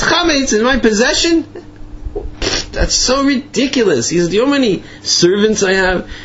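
high-pitched, spluttering outrage; acting out an affronted rich man; indignant disbelief. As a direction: protest as if grossly insulted. Retold Ziskin indignantly denies accusation.

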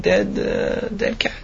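whispered, slow reveal of the horror; hushed whisper. Acted. Tenants peeking into the bag, whispering in shock. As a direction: breathe it out like a shocking secret. Tenants find the hidden cat.

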